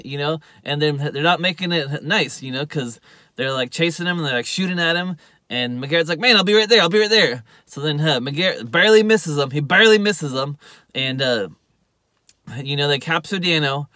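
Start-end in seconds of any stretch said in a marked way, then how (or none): 6.2-7.4 louder, higher mock urgency; urgent reassurance. McGarrett answers Dano's call for help, urgently. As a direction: act out a hurried promise. Retold urgent promise to a friend.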